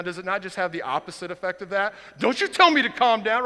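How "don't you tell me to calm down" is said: louder, higher indignant snap back; hammed-up angry comeback; mock angry retort. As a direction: fire it back, offended. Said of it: "When you're mad and someone tells you".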